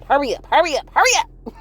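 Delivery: rushing them in, high and loud; impatient doorman urging them inside; urgent hurry. Retold or imitated. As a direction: hurry them through the door, voice climbing. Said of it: "He was like".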